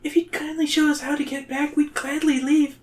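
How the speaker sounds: very high, polite little voice; Zoe. A narrator's reading. Zoe asks the king for directions, high nervous politeness. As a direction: plead politely, high and small. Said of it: "when she's nervous".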